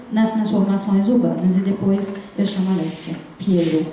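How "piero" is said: ordinary talk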